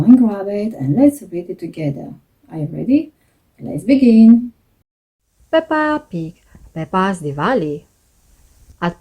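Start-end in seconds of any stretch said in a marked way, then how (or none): none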